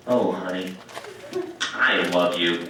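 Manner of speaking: low, intimate mock-loving husband; husband close to camera. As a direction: drop low and profess love into the camera. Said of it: "gets in real close to the camera and goes".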